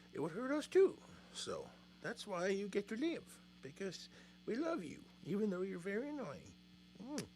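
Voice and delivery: very soft, high voice to a cat; fond pet-talk. Real, not acted. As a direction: coo affectionately at a naughty pet. Talking fondly to the annoying cat.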